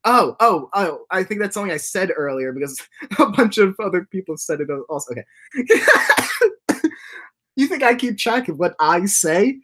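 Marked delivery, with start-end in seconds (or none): 5.5-6.9 high giggle. Real, not acted. Streamer cracking up at chat.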